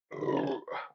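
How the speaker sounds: low, rumbling burp; real beer burp. Real, not acted. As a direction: let a low burp escape. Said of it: "pardon me".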